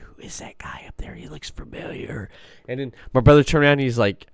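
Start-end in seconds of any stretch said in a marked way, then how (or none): 0.0-2.3 whispered gossip behind someone's back; hushed whisper. Retold or imitated. People in the liquor store line wondering, hushed whisper. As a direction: imitate onlookers whispering to each other.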